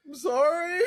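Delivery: high, loud plaintive squeak; poor drive-thru worker. Retold or imitated. Drive-thru worker answering the yell, high pleading mock apology. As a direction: imitate a startled apology, near laughter. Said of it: "the poor guy ... is like".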